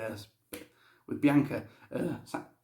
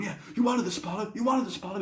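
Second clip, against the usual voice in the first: mock taunt, pitched up; higher-voiced imitation of an in-ring taunt; 7 semitones higher, faster than usual. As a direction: goad a beaten opponent, imitating a wrestler. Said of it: "He's just beating him up".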